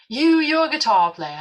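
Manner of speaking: loud, slow, high English proclamation; drawn-out imitated English declaration; English accent. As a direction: announce it grandly in an English accent. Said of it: "how he said it in this accent".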